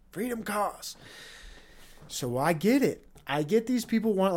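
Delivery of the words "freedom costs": Freedom costs dropped softer in an odd put-on voice; understated sinister echo of the slogan; mock ominous, softer. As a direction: let the slogan fall quiet and grim. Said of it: "can then say".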